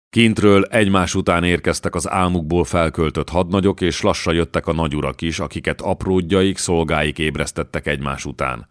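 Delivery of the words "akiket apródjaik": plain reading voice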